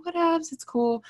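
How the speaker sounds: high, breezy and unbothered; sing-song carefree whatevs. Retold or imitated. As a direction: toss it off like it's nothing. Retelling her breezy reply.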